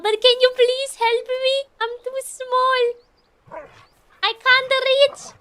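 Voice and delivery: a small stuck cat begging the dog for a lift, tiny pleading voice; tiny helpless cat. Pretend. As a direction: play the small cat who can't reach the ledge. Voicing the small cat character.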